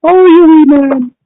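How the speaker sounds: high, drawn-out mock Scottish; slow, high-pitched comic Scottish accent. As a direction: stretch it out in a hammed-up Scottish accent. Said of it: "you did a Scottish accent there".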